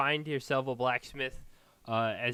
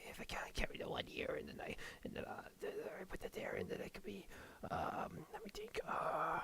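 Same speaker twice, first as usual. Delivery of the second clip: whispered counting under his breath, much softer; muttering to himself; 11 dB quieter than usual. Acted. Dwarf smith counting his price to himself, whispered. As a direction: mumble the sums so nobody hears. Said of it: "he's, like, muttering to himself".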